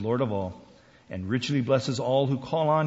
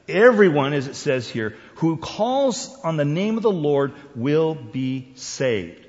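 emphatic scripture declaration led by a booming "everyone"; totally emphatic; 3 semitones higher, 4 dB louder than usual. Shown as a demonstration. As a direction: hit "everyone" hard, then stretch "will be saved". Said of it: "if you want to get totally emphatic".

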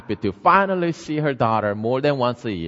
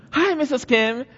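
cheerful neighbor; 12 semitones higher than usual. Acted. Neighbor greeting retold.

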